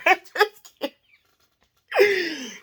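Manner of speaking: high laugh into a giggly line. Real, not acted. Joking to viewers after the manager story, laughing.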